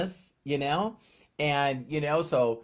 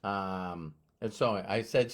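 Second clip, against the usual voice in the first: usual speaking voice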